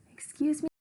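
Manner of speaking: muffled and small from inside. Acted. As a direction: speak as if inside a hamper. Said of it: "his small, muffled voice from the hamper".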